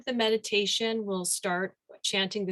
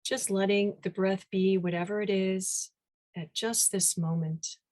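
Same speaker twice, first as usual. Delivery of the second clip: guided meditation. Real, not acted. Teacher leading the group into meditation, unhurried guided-meditation delivery. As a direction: ease them gently into stillness, phrase by phrase.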